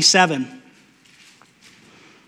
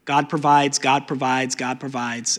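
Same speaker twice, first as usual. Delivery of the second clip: softer each time; fading refrain; 4 semitones lower, 4 dB quieter than usual. Acted. Preacher repeats God provides to the congregation, fading. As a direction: let God provides fade away.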